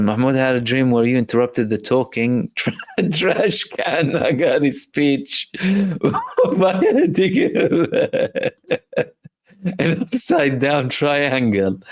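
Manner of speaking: reading through giggles; can barely get the words out for laughing. Real, not acted. Reading a funny viewer comment.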